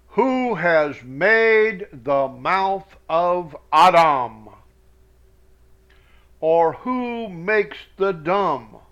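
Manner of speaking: slow, weighty reading of God's words; solemn scripture reading. Acted. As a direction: read it like a preacher, slowly. Reads God's words aloud solemnly.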